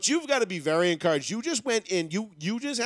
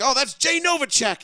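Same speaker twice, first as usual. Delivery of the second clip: mock drunk uncle; 7 semitones higher, 5 dB louder than usual. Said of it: "it's like".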